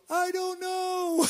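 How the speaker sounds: high, slow, drawn-out whimper of cluelessness; sheepish helplessness. Retold or imitated. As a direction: play it utterly lost and a little pathetic. Helpless answer again.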